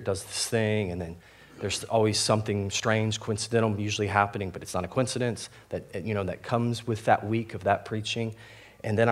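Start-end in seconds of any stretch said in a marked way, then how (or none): none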